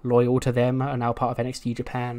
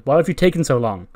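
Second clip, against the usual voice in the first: mock scolding; 7 dB louder than usual. Boss scolds assistant over coffee.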